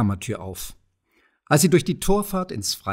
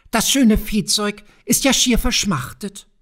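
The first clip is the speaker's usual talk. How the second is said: higher, falsely friendly goblin voice; sly goblin; 9 semitones higher, 4 dB louder than usual. A narrator's reading. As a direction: slip the trap in with a smirk. Kobold feigns concern.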